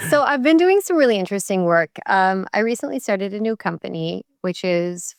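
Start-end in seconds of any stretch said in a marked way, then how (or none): 0.1-1.9 laughing. Real, not acted. Laughs into her update.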